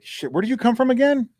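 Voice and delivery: incredulous. Retold or imitated. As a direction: ask it in high disbelief. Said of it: "I'm just like".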